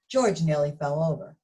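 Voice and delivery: ordinary delivery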